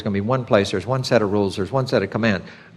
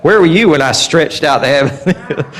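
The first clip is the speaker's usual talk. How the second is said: louder, laughing challenge from on high; God's challenge from Job; 3 semitones higher, 5 dB louder than usual. Retold or imitated. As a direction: boom it out as God, half laughing. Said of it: "I'm like".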